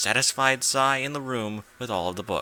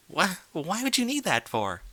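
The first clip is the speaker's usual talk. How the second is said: confused; 9 semitones higher than usual. A narrator's reading. Twilight confused by fat.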